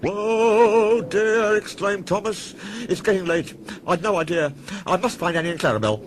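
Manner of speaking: high, loud, hurried fluster; flustered alarm. A narrator's reading. Thomas scared by the ghost, high hurried fluster. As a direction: babble in panic, rushing off.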